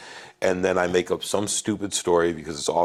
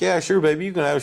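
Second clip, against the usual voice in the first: doting dad; 10 semitones higher, faster than usual. Retold or imitated. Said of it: "And I was like".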